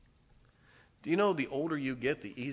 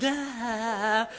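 mock cry; 11 semitones higher, 7 dB louder than usual. Friend knocked down laughing.

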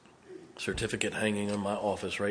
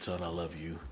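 much softer, hushed words to a son; tender fatherly blessing; 4 semitones lower, 11 dB quieter than usual. Retold or imitated. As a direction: say it gently, like a father's arm around you. Old preacher blessing his graduate.

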